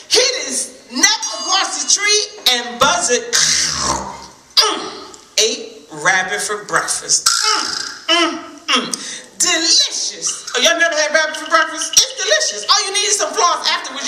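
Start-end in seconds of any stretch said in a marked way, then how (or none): 3.3-10.4 low mock mmm of tasting; relishing hum. Buzzard savoring Rabbit, mock yummy hum. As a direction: savor it aloud.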